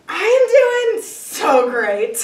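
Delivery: slow, loud, high fake enthusiasm; overdone cheerful answer. Answering people who ask how he is, fake-cheery. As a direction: stretch out a hammed-up show of feeling great. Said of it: "I usually just say".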